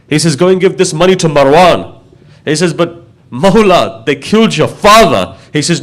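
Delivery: high, loud, fervent sermon voice; full-force preaching. Acted. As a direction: drive the lesson home to the congregation.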